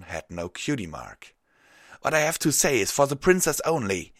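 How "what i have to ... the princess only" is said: high, loud, strained insistence; urgent, panting. A narrator's reading. Winded unicorn refusing to tell the guards, high urgent shout. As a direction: insist at a raised pitch. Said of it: "Sungazer said between pants".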